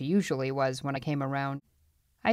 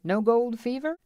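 higher, needling question; taunting; 8 semitones higher, 3 dB louder than usual. A narrator's reading. Feist needles the marshal, taunting. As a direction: rub it in with a mocking question. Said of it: "he taunted".

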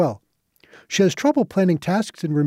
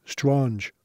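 French accent; 3 semitones lower, 4 dB quieter than usual. Shown as a demonstration. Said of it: "Again, it sounded French".

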